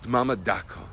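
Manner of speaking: ordinary talk, nothing marked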